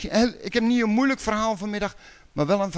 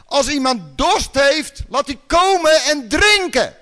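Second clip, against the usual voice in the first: calling out; 6 semitones higher, 6 dB louder than usual. Retold or imitated. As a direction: cry it out across the temple. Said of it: "Hij roept het uit". (luid uitroepen)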